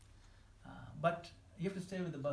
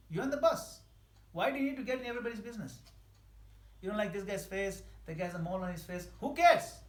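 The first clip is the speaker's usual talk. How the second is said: loud, high preaching push; rally the room with big rising energy; fiery sermon voice, higher and louder; preaching at full force; 4 semitones higher, 7 dB louder than usual. Acted.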